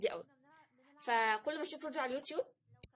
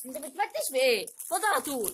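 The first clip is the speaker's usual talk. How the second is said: scolding; 2 semitones higher, 8 dB louder than usual. Real, not acted. Scolding husband while he digs.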